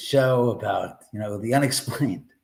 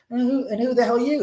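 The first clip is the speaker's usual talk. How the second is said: surprised question; 11 semitones higher, 3 dB louder, faster than usual. Retold or imitated. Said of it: "He goes".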